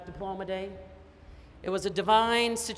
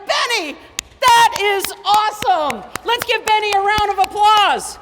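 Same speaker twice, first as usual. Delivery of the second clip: much higher and louder rallying cheer; excited cheer; 11 semitones higher, 12 dB louder than usual. Retold or imitated. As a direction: hype the crowd like an MC. Cheering Benny on stage.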